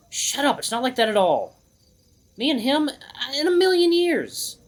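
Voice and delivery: high, strained protest; indignant denial. Acted. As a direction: deny it hotly, dragging out the last words. Hero argues heatedly against teasing thought.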